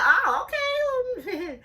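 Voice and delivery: high, loud, drawn-out squeal; squealed put-on enthusiasm; mock roller-coaster squeal.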